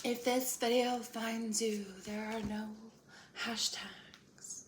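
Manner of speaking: drawn-out singsong send-up; mocking tarot readers. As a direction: parody a social media tarot opener. Mocking tarot social media openers.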